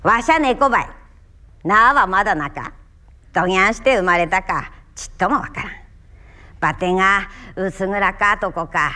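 story told in Kumamoto dialect. Shown as a demonstration. Actor showing one story in many dialects, Kumamoto dialect recitation. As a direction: recite it with a hearty Kumamoto lilt. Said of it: "いろいろな方言で喋ってみますから".

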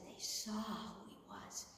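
slow, whispered reveal; breathless recognition; awed whisper. Acted. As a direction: hush for the big moment. Moment of recognition.

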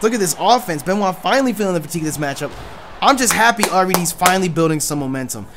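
plain live play-by-play call; wrestling commentator. Acted. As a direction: call the match like a ringside announcer.

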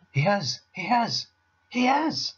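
high, squeaky little squirrel; tiny squirrel losing its head; hysterical squeak. A narrator's reading. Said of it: "it squeaked".

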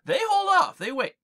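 usual speaking voice

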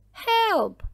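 high, loud cry for help; frightened shout. A narrator's reading. Milly sees the pirate leave the picture, frightened shout. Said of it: "shouts Milly".

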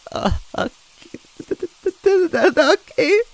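high, loud trembling stammer; shaky reply. A narrator's reading. Luigi answering Goombella, high trembling stammer. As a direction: stammer out a scared okay. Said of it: "I replied shakily".

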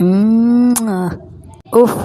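smacking kiss and pleased moans; hammed-up kiss and groan; lecherous kissing sighs. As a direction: kiss the photo and sigh with delight. Old man kisses the photo.

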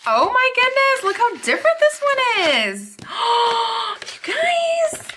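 delighted squeal. Real, not acted. Unboxing a different planner kit.

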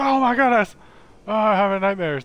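high, loud hammed-up waking cry; mock nightmare panic. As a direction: wake up wailing in pretend terror. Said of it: "Nightmare Guy wakes up and goes".

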